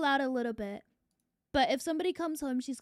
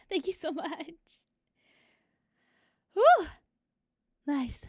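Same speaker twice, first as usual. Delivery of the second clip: panting and out of breath; winded after playing with the dog; 4 semitones higher, 6 dB louder, slower than usual. Real, not acted. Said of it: "I'm so out of breath".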